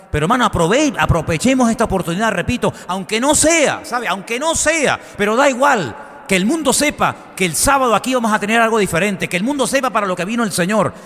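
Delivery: rally the congregation to act; impassioned call to the church; preaching at full force. Acted. Rising exhortation to the congregation.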